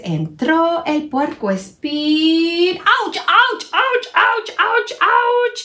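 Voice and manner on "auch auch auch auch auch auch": high pained yelps; ouch cries. Acted. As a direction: squeal at each prick. Espinas pinchan a todos. (quejidos agudos de dolor)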